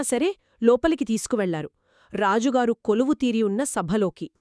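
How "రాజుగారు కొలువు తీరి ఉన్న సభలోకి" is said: ordinary delivery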